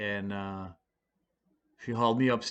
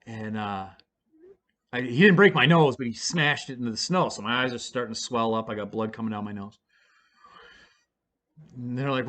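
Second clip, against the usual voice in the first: ordinary talk, nothing marked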